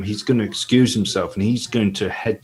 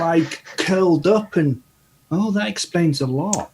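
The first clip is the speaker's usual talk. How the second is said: put-on higher, curious boy's voice; young lad voice; 9 semitones higher than usual. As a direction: ask it like a wide-eyed lad. Gully realises.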